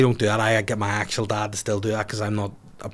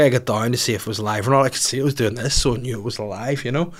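ordinary delivery

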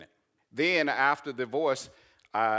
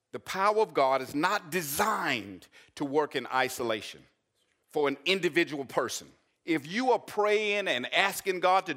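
rising, louder, higher preaching that slows at the peak; preaching at full force; 8 semitones higher, 6 dB louder than usual. Acted. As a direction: build the sermon to a slow shouted peak. Preaching climax.

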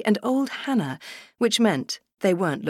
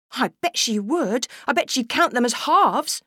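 higher, knowing little sister; teasing girl; 7 semitones higher than usual. Acted. Sister to brother about Granny, lightly teasing. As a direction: tease him like a smug small girl.

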